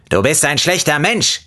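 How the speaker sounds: high-pitched mock yell from a lemon; a hammed-up shrill-voiced citrus insult. A narrator's reading. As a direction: squeal it at him like a furious lemon. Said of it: "schrie sie".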